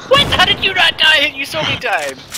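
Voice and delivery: high incredulous disbelief; surprised. Real, not acted. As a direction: squeak out the disbelief. Disbelief enemy survived.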